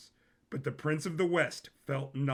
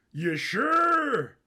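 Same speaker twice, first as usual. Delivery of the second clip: pitched up, loud and questioning; a high, incredulous prince; higher Sisko voice; 11 semitones higher, 8 dB louder than usual. A narrator's reading. Narrator voicing Prince Sisko checking with his cousin, higher and louder.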